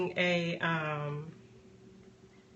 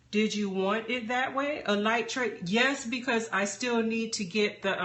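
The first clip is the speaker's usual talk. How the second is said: normal talking voice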